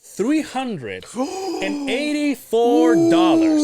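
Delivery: high, loud, very drawn-out cry; outraged exclamation. Real, not acted. Telling friend the shocking towing bill, outraged exclamation. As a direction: stretch every word in loud disbelief.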